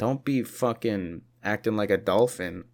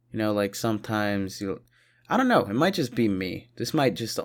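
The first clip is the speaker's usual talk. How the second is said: ordinary talk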